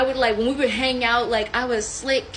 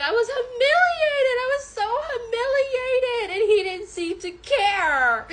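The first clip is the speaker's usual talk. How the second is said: high, whiny lament; theatrical wail; 11 semitones higher than usual. Real, not acted. Storyteller humiliated and ignored, theatrical wail. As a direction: wail it high, dragging out the complaint. Wails about her humiliation.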